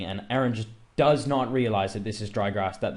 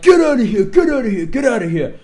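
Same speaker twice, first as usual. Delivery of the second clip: much higher, much louder shout fading back down; mock shout; 10 semitones higher, 10 dB louder than usual. Gang chasing the narrator away, high shout fading. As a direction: yell them off, then let it die down. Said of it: "and then they're like".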